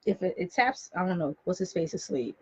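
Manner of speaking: quiet aside. Real, not acted. Host puzzling over a knock on the wall, quiet aside. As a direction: drop to a low aside, off the broadcast.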